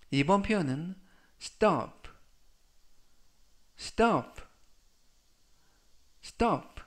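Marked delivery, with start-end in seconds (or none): 1.4-6.8 "stop" crisp and short, falling; careful pronunciation demo. Shown as a demonstration. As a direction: model "stop" clearly for students. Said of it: "라고 발음하시면 되겠고요".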